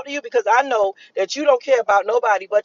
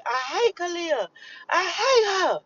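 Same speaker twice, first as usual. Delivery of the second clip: imitating a screaming child; 8 semitones higher, 4 dB quieter, slower than usual. Aunt mimicking little Kalani screaming in a high mock yell. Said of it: "she was screaming".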